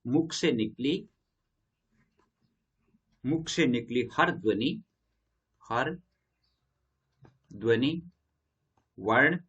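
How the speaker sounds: slow, word by word, as if for notes; slow dictation. Shown as a demonstration. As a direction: dictate each word with pauses for writing. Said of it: "इसको हम ऐसे लिख सकते हैं". (धीरे-धीरे लिखवाते हुए)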